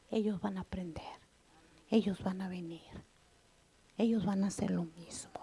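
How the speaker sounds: breathy hushed preaching whisper. Real, not acted. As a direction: drop to a whisper to draw them in. Intimate point in sermon. (predicando en susurro)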